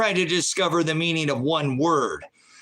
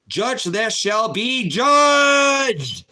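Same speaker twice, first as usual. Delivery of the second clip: high, loud shout with the last word stretched long; preaching yell; 9 semitones higher, 6 dB louder, much slower than usual. Real, not acted. Host warning people of God's judgment, preaching shout. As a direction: thunder a judgment from the pulpit. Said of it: "I like to yell a bit in here".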